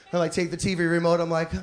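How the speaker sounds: ordinary delivery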